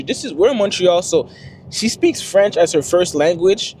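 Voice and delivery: ordinary talk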